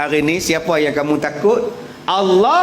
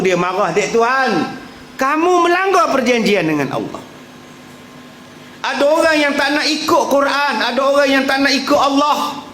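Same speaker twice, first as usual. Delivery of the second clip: fiery preaching; 9 semitones higher than usual. Acted. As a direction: preach it hard to the congregation. Preacher at full force.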